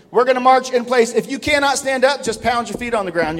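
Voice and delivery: high, loud commands to march in place; drill instructor. Acted. Preacher ordering the church to march like a sergeant. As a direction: bark it like a staff sergeant on the field. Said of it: "drill sergeant commands congregation".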